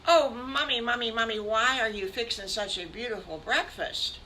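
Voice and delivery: high, loud Mommy calls fading to a question; eager kids. Acted. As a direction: rush in calling for Mommy. Piglets run to Mommy.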